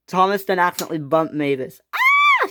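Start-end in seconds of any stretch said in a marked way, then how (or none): 1.9-2.5 very high, piercing shriek; sudden loud high scream. Acted. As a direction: shriek as if knocked off your feet. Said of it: "she screamed".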